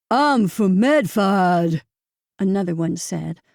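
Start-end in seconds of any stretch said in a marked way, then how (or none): 0.1-1.8 slow, louder, mocking thick Boston accent. Prep school friend mocking her father's Boston accent. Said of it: "repeating the word Somerville in a thick, heavy accent, mimicking her father".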